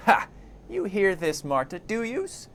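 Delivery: high-pitched crone talking to herself; indignant Marta. Acted. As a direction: push it up high, ending on an outraged question. Marta indignant at corpse's threat.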